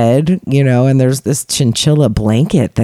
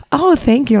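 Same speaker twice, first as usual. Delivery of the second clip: much higher, flattered; 11 semitones higher than usual. Retold or imitated. Thanking the narrator for the compliment, high. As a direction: breathe thanks way up. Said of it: "she's like".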